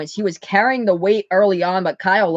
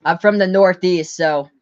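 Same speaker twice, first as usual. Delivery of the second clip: usual speaking voice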